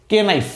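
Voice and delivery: "কেনাইফ" as kay-nife; K pronounced. Shown as a demonstration. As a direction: sound the silent K in "কেনাইফ". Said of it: "স্বাভাবিকভাবে এর উচ্চারণ হওয়ার কথা ছিল".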